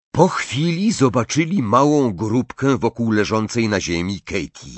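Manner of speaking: usual speaking voice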